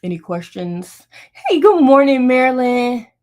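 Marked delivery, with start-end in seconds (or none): 1.3-3.1 high, loud, bright hello to a viewer; excited greeting. Real, not acted. Quilter greeting a live viewer who joined, high and loud. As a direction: call out a happy good morning.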